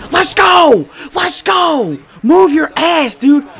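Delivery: high, loud hype yell; real full-voiced cheering; excited shouting. Real, not acted. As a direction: yell them down the field. Cheering a fumble and return.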